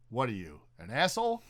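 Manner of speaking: deadpan "What are you," pause, upturned "an asshole?"; pause at the comma. Retold or imitated. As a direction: set off "an asshole" after a clear comma. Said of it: "I've never heard a comma in, in, in an inflection".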